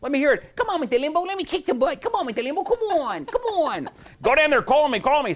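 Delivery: hammed-up Tyson taunting Limbaugh; Mike Tyson impression. Doing his Tyson bit for Rush, high hammed-up. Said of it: "I do a stupid Mike Tyson impression".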